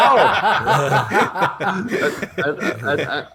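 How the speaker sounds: real laughter breaking into the words; high and laughing, barely able to go on. Real, not acted. [name] laughing while telling the interviewer about Terry. Laughing at his own story.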